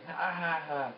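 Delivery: mock laugh; imitated mocking laugh. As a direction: imitate a taunting villain's laugh. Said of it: "And he laughed again".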